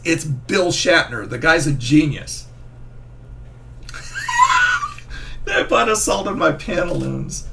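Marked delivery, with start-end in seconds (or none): 3.8-5.4 shrieking burst of laughter. Real, not acted.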